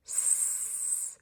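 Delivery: seed stretched slowly into S, E, ed; stretched word. Shown as a demonstration. Teacher stretches seed for the class, slow and drawn out. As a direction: pull seed apart, sound by sound. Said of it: "Let's do that one more time".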